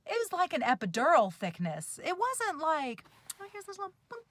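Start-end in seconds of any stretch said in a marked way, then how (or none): 3.4-4.2 high, soft, teeny mock voice; little squeaky-small aside; tiny dainty voice. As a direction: imitate something tiny and harmless, high and light. Mimics a tiny, dainty needle.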